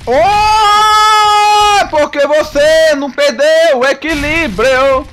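loud, slow teasing sing-song; playful gloating taunt; mocking taunt. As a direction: drag it out to mock the boss. Mocking the boss.

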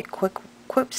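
tongue ring in the mouth; tongue piercing. Real, not acted. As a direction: talk around a tongue ring. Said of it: "Oh, see, can't talk".

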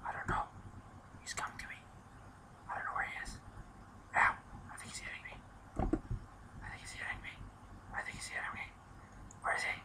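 tense whisper while hiding from zombies; barely voiced, scared to be heard. Real, not acted. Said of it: "Why am I whispering?".

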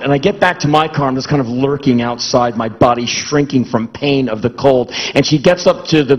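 ordinary talk, nothing marked

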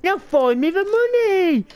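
high, loud barked demand; Locke demanding. Retold or imitated. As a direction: shout it like a boss wanting his cash. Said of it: "then being like".

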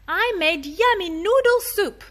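put-on high, proud child voice; little boy voice. Daniel inviting mom to his pretend restaurant, high child voice. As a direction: show off the soup like a small kid. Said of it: "Daniel said".

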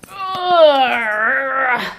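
loud exaggerated groan; dramatic groan. Real, not acted. She groans at the dark, loud and overdone. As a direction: overplay the groan on purpose. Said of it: "that was a little dramatic".

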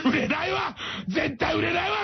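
high, shouted jeer; mock yell. Beluga jeering that Tashiro will never sell, shouted jeer. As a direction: yell it as a cruel verdict. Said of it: "みたいになっちゃうんですよ".

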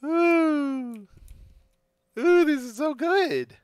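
skeleton voice. Acted. Delighted skeleton voice.

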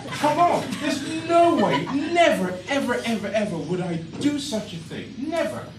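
high, loud outraged denial; indignant protest. Real, not acted. Comic denies harming the dog to the audience, outraged. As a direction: protest your innocence loudly.

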